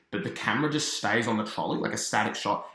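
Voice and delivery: ordinary talk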